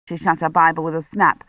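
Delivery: ordinary delivery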